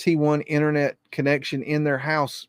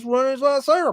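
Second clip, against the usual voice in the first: mock commander order; 9 semitones higher than usual. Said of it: "gets on the radio and says".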